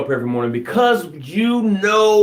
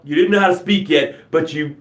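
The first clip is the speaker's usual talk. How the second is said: ordinary delivery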